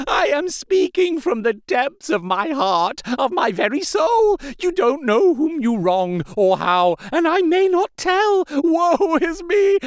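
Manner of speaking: high, desperate pleading building to a wail; frantic tearful entreaty. A narrator's reading. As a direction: beg on your knees with tears rolling down. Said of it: "poured forth a torrent of entreaty".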